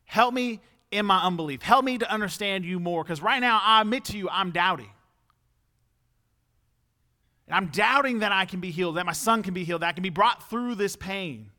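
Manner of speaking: pleading. Acted. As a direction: confess the doubt loudly, begging for help. Acts out desperate father's plea.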